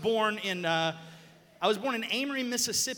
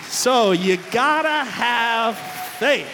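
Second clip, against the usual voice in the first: emphatic; 4 semitones higher, 16 dB louder, much slower than usual. Real, not acted. Preacher punchline to congregation.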